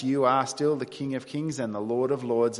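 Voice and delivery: normal talking voice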